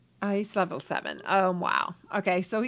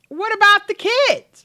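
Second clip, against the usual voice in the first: put-on high, louder "What about the kids?"; mock alarmed question; 10 semitones higher, 9 dB louder than usual. Boyfriend calling after his girlfriend about the kids, alarmed. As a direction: call after her, hammed-up. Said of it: "And he's like".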